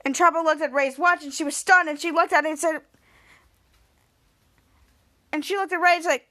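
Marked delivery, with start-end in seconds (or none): none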